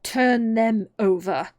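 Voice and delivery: slow, loud command; angry. A narrator's reading. Queen ordering the Knave, angrily. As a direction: give the order with heavy, drawn-out anger. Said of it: "turned angrily away".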